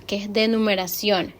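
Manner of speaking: normal talking voice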